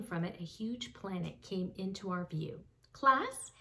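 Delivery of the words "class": a light hint of a teacher voice; playing teacher. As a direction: address the class like the teacher would. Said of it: "said Janet".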